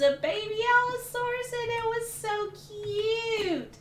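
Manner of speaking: high-pitched cooing gush; high, smitten squeak; gushing over cuteness. Real, not acted. Gushing over a cute baby dinosaur.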